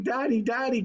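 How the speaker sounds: put-on high, loud child's call; light imitation of excited kids; children calling. Kids calling to their dad, lightly voiced. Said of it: "they're saying".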